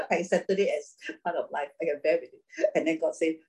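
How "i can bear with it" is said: usual speaking voice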